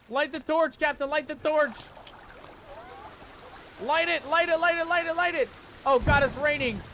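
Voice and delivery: high, urgent repeated orders; frantic urging. Real, not acted. Player needing the torch lit, frantic. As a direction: panic and urge them to hurry.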